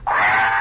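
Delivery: loud, sustained imitated scream; fantastic scream. Storyteller mimics the scream from the dentist's office, long loud scream. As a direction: let out a long horror-movie scream. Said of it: "fantastic scream".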